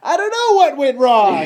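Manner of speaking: captain losing it, voiced like Woody; Woody screaming. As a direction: break the accent into a cartoon scream. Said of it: "that was Woody screaming".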